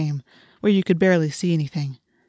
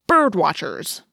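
scornful snort; slower than usual. A narrator's reading. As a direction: scoff at the very idea. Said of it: "Marco snorted".

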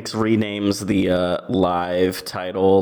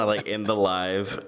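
ordinary talk, nothing marked

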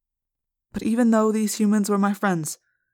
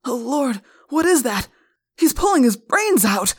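higher and louder, recoiling in shock; horrified cry; 6 semitones higher, 6 dB louder than usual. Acted. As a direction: react to something monstrous. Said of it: "one policeman cried in horror".

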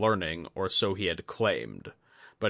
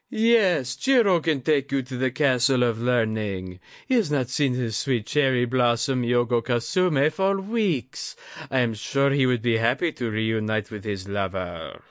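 put-on high, louder sly old woman; teasing old lady; 4 semitones higher, 4 dB louder than usual. A narrator's reading. Lady Atsuko teases Jiro about his lover, high and sly.

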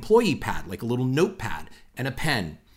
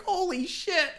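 very high, soft, disbelieving squeak; shocked gasp; 6 dB quieter than usual. Retold or imitated. Reacting to his buddy's insult, a very high, soft, shocked squeak.

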